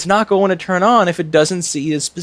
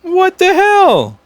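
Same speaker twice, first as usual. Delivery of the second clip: surprised; 6 dB louder, much slower than usual. Real, not acted. Measurement suddenly changed.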